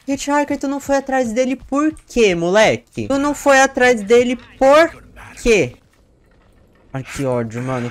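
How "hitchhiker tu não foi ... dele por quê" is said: high loud exasperated scolding; angry scolding. Real, not acted. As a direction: chew out the teammate. Scolding teammate for not chasing.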